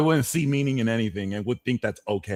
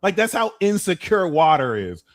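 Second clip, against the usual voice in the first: ordinary delivery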